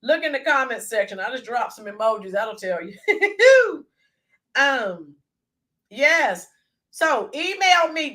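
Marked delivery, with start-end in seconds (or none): 3.0-3.8 high, loud giggle; a real burst of laughter; laughing at her own joke. Real, not acted.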